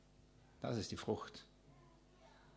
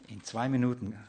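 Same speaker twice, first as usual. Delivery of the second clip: normal talking voice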